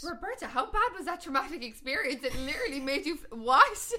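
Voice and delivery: can't believe what you're hearing; astonished. Real, not acted. Host asking Roberta in disbelief how she became fluent.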